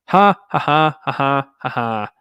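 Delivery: loud, scornful fake ha ha ha laugh; mocking laugh. Real, not acted. Vlogger scoffing at the Garda Commissioner, loud mocking laugh. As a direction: laugh the claim off with open scorn.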